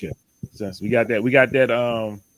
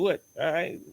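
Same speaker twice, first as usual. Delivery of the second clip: usual speaking voice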